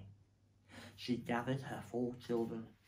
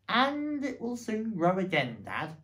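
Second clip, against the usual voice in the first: high, loud little fox voice; a hopeful little cub; small fox child; 8 semitones higher, 8 dB louder than usual. A narrator's reading.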